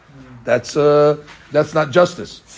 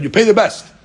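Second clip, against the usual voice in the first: mock pronouncement; 8 semitones higher, 5 dB louder than usual. Rabbi Ishmael quoted, then mocked.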